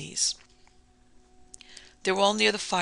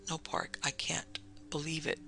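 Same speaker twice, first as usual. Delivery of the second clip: soft, breathless, barely voiced; shocked whisper; 9 dB quieter than usual. Taffy whispering to Park in the dark, stunned.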